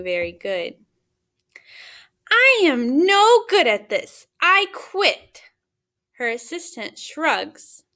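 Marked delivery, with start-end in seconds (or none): 2.3-5.4 higher, louder frustrated quitting; frustrated outburst. Acted. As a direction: fling it out like giving up in a huff. Character quits in frustration.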